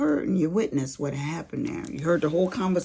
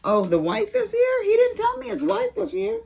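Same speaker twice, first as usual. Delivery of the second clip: much higher, louder surprise; surprised; 7 dB louder than usual. Real, not acted. Mother hears the guest's wife is downstairs, surprised and louder. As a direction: caught off guard that guests are here.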